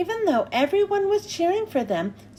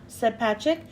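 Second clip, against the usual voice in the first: ordinary delivery, nothing marked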